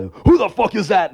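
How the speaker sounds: loud, high, startled demand; angry boyfriend. Retold or imitated. Mother's boyfriend caught in bed with her, acted angry outburst. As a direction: snap at the intruder, acted outrage.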